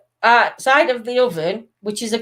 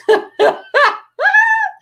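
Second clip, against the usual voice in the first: loud, high cackling laugh; real laugh, pitched way up; high cackle; high cackle of laughter; 4 dB louder than usual. Real, not acted. Laughing at stripper joke.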